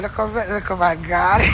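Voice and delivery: ordinary delivery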